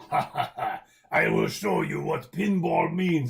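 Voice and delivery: a hint of a gloating movie villain; Bond villain voice. Imitating pinball artist Python to viewers, mild Bond villain voice. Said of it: "he talked like a Bond villain".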